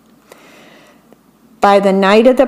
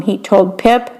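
ordinary delivery

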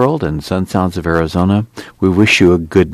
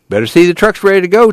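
quick, higher warning to his brother; 13 semitones higher, 3 dB louder, much faster than usual. A narrator's reading. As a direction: warn him fast, pitched up. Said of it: "Tom warned him".

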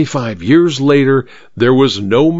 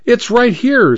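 eager; 13 semitones higher than usual. Acted. Vicar points out dagger.

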